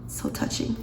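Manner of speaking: near-whispered, deeply moved; sighing, moved. Real, not acted. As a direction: let it out on a sigh, touched. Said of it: "I've just been emotional".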